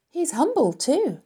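high-voiced bystander remark; woman onlooker. A narrator's reading. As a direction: chime in as a woman in the crowd. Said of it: "said a woman".

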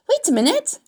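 put-on high, loud boyish outburst; Avery's loud, high-pitched interruption; excited cry. A narrator's reading. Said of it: "cried Avery".

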